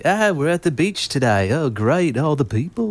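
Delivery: high, wobbly attempt at an Australian accent; amateur Australian impression, high and swooping. Shown as a demonstration. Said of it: "start with an Australian accent or something".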